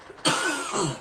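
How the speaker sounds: heavy cough. Real, not acted. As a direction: cough it out hard. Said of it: "I'm having a cough attack up in here".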